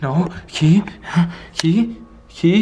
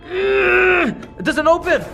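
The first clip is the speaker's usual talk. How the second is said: slow, high, effortful cry; straining yell; 5 semitones higher, much slower than usual. Acted. Fighting a thing that won't open, straining frustrated yell. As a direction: yell while heaving at something stuck.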